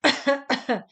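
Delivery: a loud, short demonstrative cough to show the word; demo cough. Shown as a demonstration. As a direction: cough once sharply to act out the word. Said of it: "köhögés".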